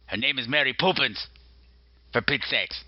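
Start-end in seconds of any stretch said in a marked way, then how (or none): 0.5-1.3 Poppins said as "POO-pins"; "Poppins" turned into "Poopins"; deliberate mispronunciation. Pretend. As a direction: say Poppins with an "oo" in the first syllable. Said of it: "If you pronounce it the way I pronounce it".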